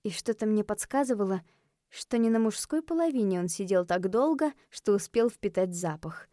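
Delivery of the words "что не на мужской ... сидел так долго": ordinary delivery, nothing marked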